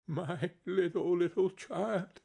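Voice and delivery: high, grief-stricken father's cry; breaking down. A narrator's reading. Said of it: "He broke down all at once".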